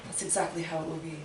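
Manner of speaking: sly aside. Acted.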